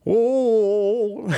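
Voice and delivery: high rock wail; high screaming rock wail. Retold or imitated. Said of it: "that's my best [name]".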